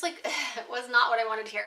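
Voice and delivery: ordinary talk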